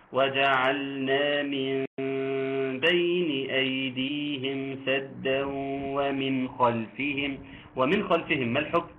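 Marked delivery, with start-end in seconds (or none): 0.1-7.4 slow, drawn-out chanted recitation; measured Quranic chant. Shown as a demonstration. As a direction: recite slowly with tajweed. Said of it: "الإخفاء".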